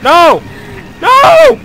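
real panic as the character dies; shouting no. Real, not acted. Dying in game, shouting.

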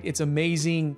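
ordinary talk, nothing marked

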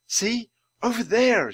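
plain reading voice

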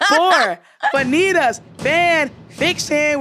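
slow, high show intro; announcer voice. Acted. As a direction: announce it like a radio host.

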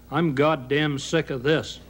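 ordinary delivery

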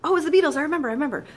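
sudden delight at a half-known song; imitating a thrilled partygoer; excited recognition. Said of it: "You're like".

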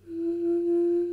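"Mm" hummed high and loud; a high steady closed-mouth "Mm" hum. Shown as a demonstration. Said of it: "Here's the first humming noise".

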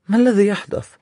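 worried question. Acted. Enkidu wonders to himself.

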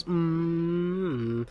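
hummed engine drone; toy car noise. Shown as a demonstration. Showing how they played with Hot Wheels, imitated engine hum. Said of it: "I would make the noises".

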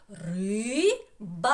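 "Риба" split into two long syllables. Shown as a demonstration. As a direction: stretch РИ, pause, then БА. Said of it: "теж два склади".

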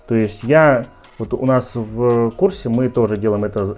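ordinary delivery

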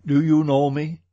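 loud call to be heard. A narrator's reading. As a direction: project firmly, calling out to him. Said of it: "Then I raised my voice".

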